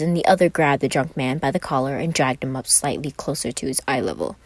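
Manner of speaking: usual speaking voice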